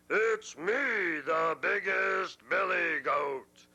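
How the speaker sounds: slow, booming shout; big goat shout. Acted. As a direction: bellow back proudly. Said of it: "George yelled back".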